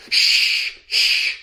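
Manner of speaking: loud hushing shh; father quieting his child; father shushing. Acted. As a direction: hush the child. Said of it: "said the father".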